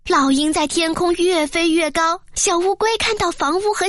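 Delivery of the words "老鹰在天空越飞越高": ordinary delivery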